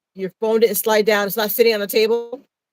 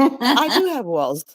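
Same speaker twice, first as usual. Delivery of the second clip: laughing; 4 semitones higher, much slower than usual. Real, not acted. Laughing at walls joke.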